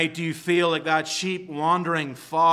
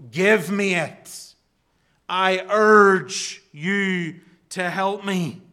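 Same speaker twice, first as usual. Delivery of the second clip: slow, loud, pressing plea; urgent demand; 3 semitones higher, 5 dB louder, slower than usual. Retold or imitated. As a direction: demand it like a desperate man. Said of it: "he cries before God".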